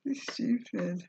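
high tearful voice breaking; real crying. Real, not acted. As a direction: speak through real tears, trying to hold it together. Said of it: "I'm crying".

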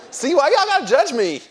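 loud, high-pitched mock protest; mock indignation. Preacher to judging audience, loud mock indignation. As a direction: playfully cry out against being judged.

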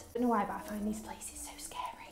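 hushed, nervous whisper. Real, not acted. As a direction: whisper like someone might hear. Scared in dark car park.

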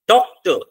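DOC of Doctor hit loud, ending falls away; stress on DOC. Shown as a demonstration. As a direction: punch the first syllable of Doctor, let the second drop. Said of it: "डॉक को ज्यादा जोर से बोल रहे".

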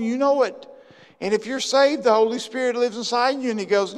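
ordinary talk, nothing marked

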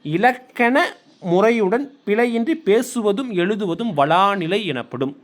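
slow, measured definition for note-taking; slow dictation. Shown as a demonstration. Teacher dictating a grammar definition to students, slow. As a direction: read it slowly so they can write it down. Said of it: "தயவு செஞ்சு எழுதி வச்சுக்கோங்க".

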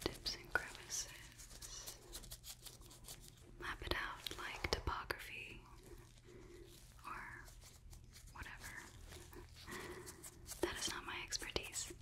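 barely audible, breath-only whisper; ASMR whisper. Acted. ASMR scan, whispered musing.

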